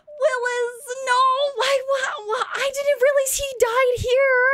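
hammed-up high, loud wailing; mock hysterical sobbing. Player grieving a dead game character, mock hysterical wailing. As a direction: wail loudly in over-the-top grief. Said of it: "I'm sobbing hysterically".

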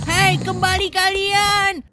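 put-on slow, loud call of a giant old man; giant grandpa calling. Giant grandpa yelling after the fleeing kids, slow loud call. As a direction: bellow after the runaways like a lumbering giant.

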